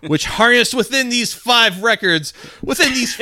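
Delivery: high, loud cartoon shouting; hammed-up cartoon mad scientist proclamation; loud, high-pitched cartoon rant; Rick and Morty character. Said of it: "Rick and Morty guy".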